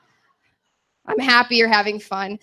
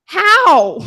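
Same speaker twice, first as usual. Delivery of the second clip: high, loud baffled how; incredulous how; 7 semitones higher, 6 dB louder than usual. Retold or imitated. Comic answering the old lady, incredulous and baffled. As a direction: fire it back in disbelief. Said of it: "And I was like".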